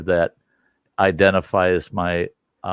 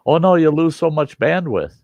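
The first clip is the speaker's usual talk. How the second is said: mock know-it-all; 8 semitones higher, 4 dB louder than usual. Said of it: "and they go".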